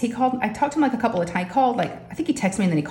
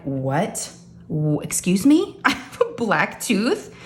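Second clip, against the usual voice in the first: slow, incredulous questioning; stunned questions, stretched out and rising at the end; freaked-out disbelief; slower than usual. Retold or imitated. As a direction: repeat the news in drawn-out disbelief. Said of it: "I freaked out".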